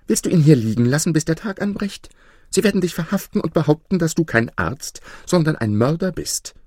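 high, hurried, urgent wife; scolding wife. A narrator's reading. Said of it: "rief seine Frau".

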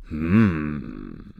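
low judge's grumble; a long, deep "hm"; grumbled hm. Acted. Judge taking in the devil's name, low grumble. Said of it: "brummte der Richter".

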